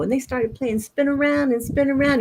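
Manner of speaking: plain reading voice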